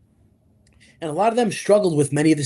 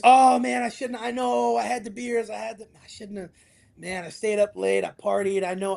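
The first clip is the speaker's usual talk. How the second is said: sheepish excuse-maker; 4 semitones higher than usual. Retold or imitated. Mocking clients' next-day excuses.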